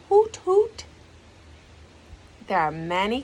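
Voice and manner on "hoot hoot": high hooting call, rising; owl hoot. Acted. Owl shows the kids her song, hooting. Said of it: "When I sing, you hear".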